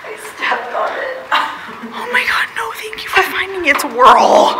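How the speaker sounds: high, loud excited squeals. Real, not acted. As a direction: gasp and squeal with relief. Found the lost item, relieved.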